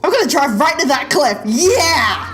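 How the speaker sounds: hammed-up glee building to a high whoop; gleeful mock excitement. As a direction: play a daredevil cheering himself on. Gleeful mock driver heading for cliff.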